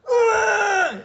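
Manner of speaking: sudden high, very loud scream; raging scream. Retold or imitated. Girlfriend waking in rage, screaming high and loud. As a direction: erupt in a shrieking rage scream. She wakes and rages.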